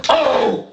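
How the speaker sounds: short pushed-out hardcore yell, high and loud. Shown as a demonstration. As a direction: bark out one hardcore scream. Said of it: "regular kind of yelling screams".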